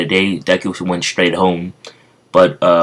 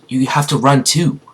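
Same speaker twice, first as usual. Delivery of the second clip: put-on urgent little girl; 7 semitones higher than usual. Girl urging Deku.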